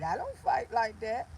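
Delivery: ordinary delivery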